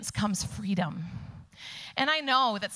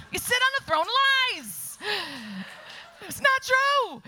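much higher and louder hammed-up protest; high, loud, mock-furious retort; mock indignation; 11 semitones higher, 3 dB louder than usual. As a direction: play it as over-the-top offended. Mock outrage at a hashtag.

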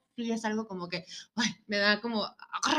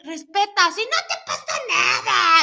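high, loud mock protest; hammed-up exasperated comeback; indignant retort; 8 semitones higher, 7 dB louder than usual. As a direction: act out pleading to be respected. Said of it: "Y yo, "Güey".

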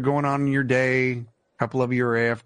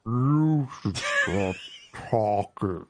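slow drawn-out words; half-speed voice; 3 semitones lower, much slower than usual. Pretend. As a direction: talk like a slowed recording. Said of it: "acting like I'm half speed".

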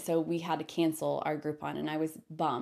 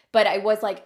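normal talking voice

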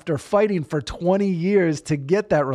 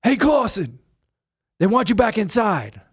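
loud, higher call across the lot; calling out to him; 8 semitones higher, 6 dB louder than usual. Retold or imitated. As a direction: shout his name like a guard joking around. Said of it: "He goes".

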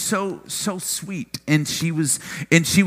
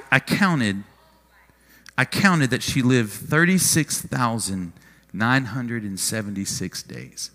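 usual speaking voice

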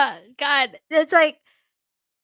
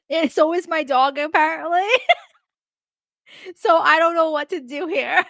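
laughing while talking; 3 semitones higher than usual. Real, not acted. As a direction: giggle through the words. Laughing through own joke.

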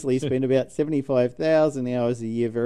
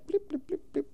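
high soft mock keypad beeps; keypad blips; 6 dB quieter than usual. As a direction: tap out quiet beeps. Imitating keypad tones.

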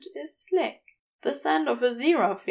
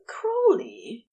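moaning name; 12 semitones higher than usual. Acted.

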